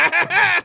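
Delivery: high, loud put-on cackle; mock villain cackle. Villain pulls his mask off, gleeful cackle. And goes.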